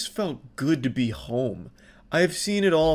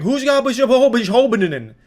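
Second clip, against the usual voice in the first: high, louder stammering gibberish; mock gibberish rant; 10 semitones higher, 7 dB louder than usual. Mocking the furious woman who can't get words out. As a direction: sputter nonsense like a furious woman. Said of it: "I don't know what the fuck she was saying".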